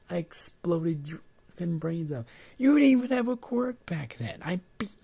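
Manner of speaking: loud, high-pitched indignant outburst; acted squabbling yell; heated argument. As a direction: shout the comeback, voice leaping up. Bakugo argues back about childhood fight.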